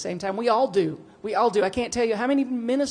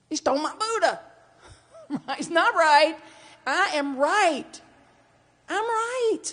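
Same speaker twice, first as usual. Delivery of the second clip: mock indignant; 10 semitones higher, 4 dB louder, slower than usual. Indignant mock Buddha quarrel.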